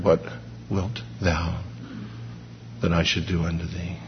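very soft and low, tender, unhurried; hushed, intimate invitation; loving question. Acted. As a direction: ask as a gentle father would. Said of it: "He heard all of God's love".

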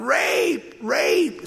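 high-pitched calling out; mock outcry. Wife falsely accusing Joseph, high mock outcry. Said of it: "she started calling out".

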